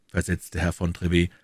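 usual speaking voice

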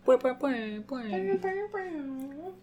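playful high bouncing boings; boing sounds. Pretend. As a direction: bounce it like a cartoon spring. Cheering patrons with bounce sounds.

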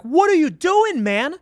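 high, loud, incredulous retold yell at the driver; retold yell at cabbie. Retelling how he yelled at the cab driver, high and loud. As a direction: throw it at him like you just leapt from the cab.

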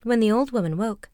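ordinary delivery

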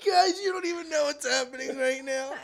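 high, crying with laughter; squealing through laughter; laughing. Real, not acted. Laughing at friend stuck on zipper.

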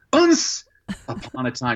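put-on little kid guessing the word; child struggling again. Boy trying again for his mom, squeaky then trailing off. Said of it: "I'd say".

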